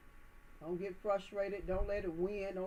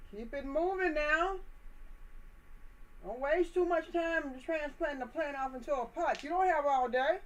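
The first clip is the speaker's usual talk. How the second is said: hyped urging; 7 semitones higher, 4 dB louder than usual. Real, not acted. Urging viewers to hurry.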